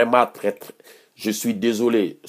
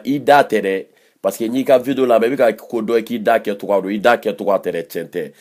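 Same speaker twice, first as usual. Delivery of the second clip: ordinary delivery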